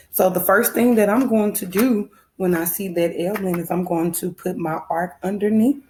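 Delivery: ordinary talk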